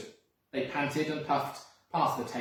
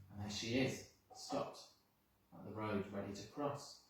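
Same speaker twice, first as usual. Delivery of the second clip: quiet aside; 4 semitones lower, 11 dB quieter than usual. Real, not acted. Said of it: "aside to listening children".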